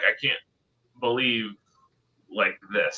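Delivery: quietly imitating an actor overwhelmed after a scene; retold tearful disbelief. Retelling the actor crying after one take, softly imitating him. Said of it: "broke down crying and was just like".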